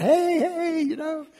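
high, bright call across the street; friendly calling out. Retold or imitated. Paradegoer calling to a friend, high and carrying.

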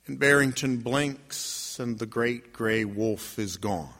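slow, low, fading storytelling; slow down and drop away; hushed narration. A narrator's reading. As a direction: let it sink down softly to a close. Wolf vanishes, hushed narration.

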